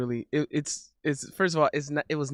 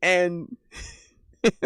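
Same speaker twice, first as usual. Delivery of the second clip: ordinary talk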